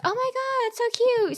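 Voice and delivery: high, gushing mock-sweet squeal; high cooing impression of a fawning guest; gushing stranger. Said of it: "And then she goes".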